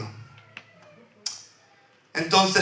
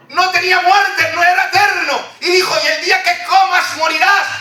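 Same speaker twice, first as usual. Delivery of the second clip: high, ringing preacher's cry, building emphasis; full-force preaching; 13 semitones higher, 3 dB louder than usual. Acted. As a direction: proclaim it to the congregation.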